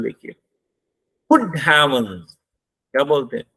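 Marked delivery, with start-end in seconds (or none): 1.3-2.4 गुड said strong, voice drops through heavens; slow, punch गुड then fall; stress on गुड, falling tone. Shown as a demonstration. Teacher demonstrating the exclamation with a falling tone, stress on गुड. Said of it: "गुड को जोर से बोलते हैं".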